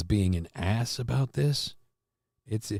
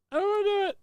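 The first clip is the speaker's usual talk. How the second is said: much higher, squeaky mock plea; high put-on voice; 4 dB quieter, much faster than usual. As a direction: squeak it out like a cartoon character begging.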